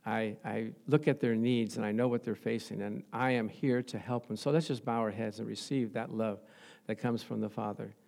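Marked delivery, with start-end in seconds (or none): none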